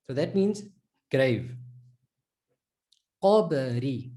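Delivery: qaba, Qabari with a full rounded qaf; teacher drilling Qabari; drilled Arabic syllables. Shown as a demonstration. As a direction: repeat Qabari slowly, heavy Qa. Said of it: "So with the qaf".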